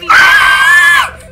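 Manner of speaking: shrill terrified shriek; high-pitched scream. Real, not acted. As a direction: scream in sheer fright. Jump scare while hiding.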